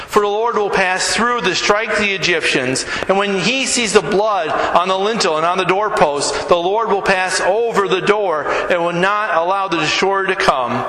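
scripture reading. Acted. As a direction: read the Exodus passage aloud like scripture. Reads Exodus passage aloud.

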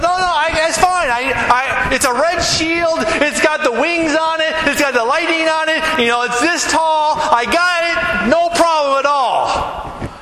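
cocky brush-off swelling into a yell; careless soldier. Retold or imitated. Soldier waving off the captain.

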